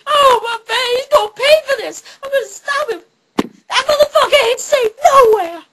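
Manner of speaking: high, loud mock-furious wail; outraged trolley driver. Survivor beside the crash vowing revenge, hammed-up shouting. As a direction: rant at full volume, hammed up. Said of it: "and he's like".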